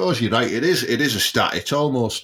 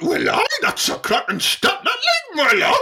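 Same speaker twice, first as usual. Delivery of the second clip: Geordie impression. Mocking how the Geordie lads talk, high Geordie voice. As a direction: pitch it way up in a mock Geordie accent. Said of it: "That's how they talk".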